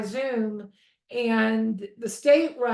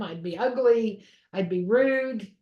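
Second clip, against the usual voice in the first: normal talking voice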